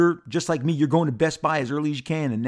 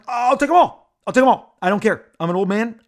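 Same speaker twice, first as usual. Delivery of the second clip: much higher and louder, giddy and grabby; greedy collector; 7 semitones higher, 8 dB louder than usual. Retold or imitated. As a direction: blurt it out like a kid in a toy store, half mocking yourself. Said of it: "I'm like".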